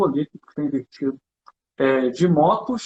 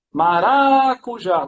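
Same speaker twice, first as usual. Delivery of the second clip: Maracujá called out slow and louder, syllables stretched; shouted test word; 3 semitones higher, 4 dB louder, much slower than usual. Shown as a demonstration. Teacher shouting the word to students to test stress, slow and loud. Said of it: "Porque eu tenho que testar no grito".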